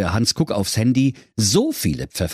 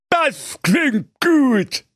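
very high, slow, through a mouthful; mouth full; 3 dB louder, much slower than usual. Acted. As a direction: say it chewing, words round the food. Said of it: "rief der König mit vollem Munde".

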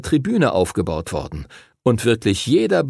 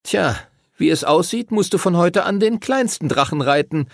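much higher, sneering taunt; mocking; 8 semitones higher than usual. A narrator's reading. Timothy mocks Henry about his small dragon. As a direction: needle him about the tiny dragon. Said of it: "lästerte Timothy".

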